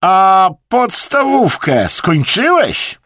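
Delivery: loud, high, drawn-out jab; attacking challenge. A narrator's reading. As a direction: go on the attack, stretching the question. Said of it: "zaatakował Brytyjczyka". (zaczepnie, głośno i wysoko)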